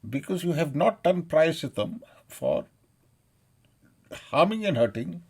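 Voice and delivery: real outraged denunciation; angry condemnation. Real, not acted. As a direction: thunder the charge phrase by phrase. Angry religious condemnation continues.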